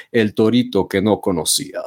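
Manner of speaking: dramatic YouTube video title voice; YouTube clickbait title. Retold or imitated. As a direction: read it like a clickbait headline. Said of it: "así ponen allí".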